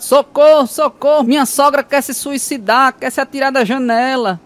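a man phoning in fake desperation; mock desperate. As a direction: beg for help in comic panic. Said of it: "liga desesperado".

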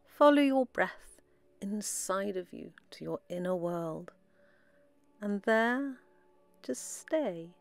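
soft, unhurried meditation voice with long pauses; guided meditation. Acted. Guide leading listeners into relaxed breathing, soft meditation voice. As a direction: guide them gently inward, softly, phrase by phrase.

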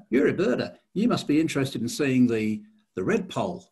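imitated Australian, starting high and loud, then settling; Australian accent. Warden guessing a birder's interest, imitated Australian accent. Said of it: "this is an Australian accent".

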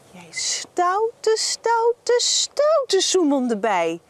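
mock stern telling-off, rising; playful scolding. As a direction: scold the naughty bee playfully. Scolding bee again.

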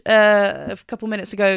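usual speaking voice

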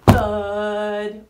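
loud, heavy thud; thud sound effect. Shown as a demonstration. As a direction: drop the word hard, like a weight. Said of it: "here comes the thud part".

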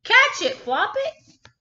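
high, loud excited shout; animals shouting. Acted. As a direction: cheer the bunny on to catch it. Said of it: "and shouted".

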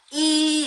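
이 with lips stretched sideways, held high; long, high, wide 이; wider-spread vowel. Shown as a demonstration. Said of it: "옆으로 조금 더 벌려주셔야 돼요".

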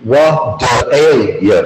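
"What ail ya" slowly, each word stretched apart; slow demo of "What ail ya". Shown as a demonstration. Teacher breaks phrase down word by word.

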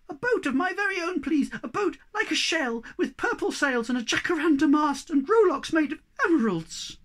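high, loud, eager squeaking; excited little high-pitched squeaker; squeaky Sniff. Acted. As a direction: pipe up like a greedy little creature making a wish. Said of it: "squeaked Sniff".

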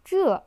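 这 in Mandarin fourth tone, starting high and falling sharply. Shown as a demonstration. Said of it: "the fourth tone".

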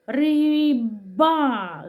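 Риба very slowly, every sound held; sounds stretched out. Shown as a demonstration. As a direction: draw out Риба to expose the Б. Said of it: "Звук Б".

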